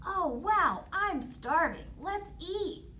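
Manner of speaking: put-on high, eager child voice; thrilled hungry child; excited kids. Children see candy house.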